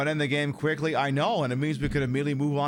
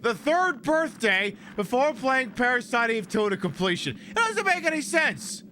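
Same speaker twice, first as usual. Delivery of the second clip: indignant rant; 9 semitones higher than usual. Real, not acted. Indignant rant about play order.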